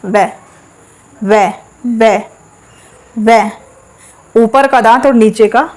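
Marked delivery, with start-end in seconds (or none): 0.0-3.4 "वे" said as separate, deliberate syllables; articulation demo. Shown as a demonstration. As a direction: isolate each "वे" for learners to copy. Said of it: "आप लोग इसे बोल के देख लो".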